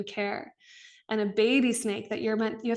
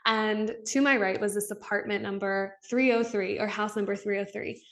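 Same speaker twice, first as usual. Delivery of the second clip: usual speaking voice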